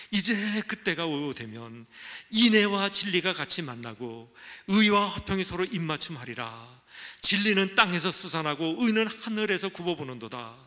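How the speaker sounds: loud, slow, declaiming the verse; solemn scripture reading. Acted. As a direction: proclaim the psalm to the congregation. Reads scripture aloud.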